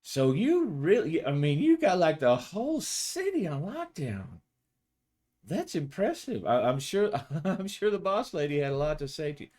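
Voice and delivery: high, mocking teasing voice of a cop colleague; a cheeky female cop ribbing her partner; teasing coworker. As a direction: needle him sweetly, high and sly. Kathy teasing Rizzo.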